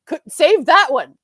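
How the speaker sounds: loud, high mock yell; exasperated viewer's shout, replayed; retold yelling. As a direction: yell it as if heckling the TV. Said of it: "I just keep yelling".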